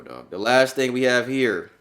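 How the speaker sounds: usual speaking voice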